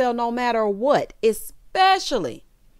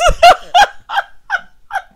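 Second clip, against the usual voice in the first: loud, high, long cackle; big high-pitched laughing fit; 11 dB louder than usual. Real, not acted. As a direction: burst into uncontrollable laughter. Cracks up at toothless quote.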